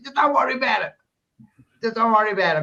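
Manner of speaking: high, fed-up brush-off; exasperated retold dismissal. Customer giving up on the wrong coffee, exasperated. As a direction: wave the server away, giving up.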